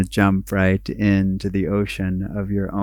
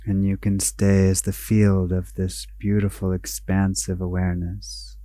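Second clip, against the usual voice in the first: guided meditation; 6 dB quieter than usual. Acted. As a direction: guide them inward, unhurried, letting each phrase settle.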